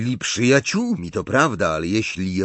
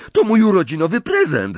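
high, loud, quick protest; calling out; 10 semitones higher, 5 dB louder, faster than usual. A narrator's reading. Dangling boy defending his sweets, calling out. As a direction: cry it out fast and high. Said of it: "zawołał Ron".

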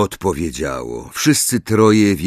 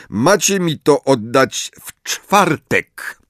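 stern teacher voice; 5 semitones higher, 3 dB louder than usual. Acted. Snape giving orders to class.

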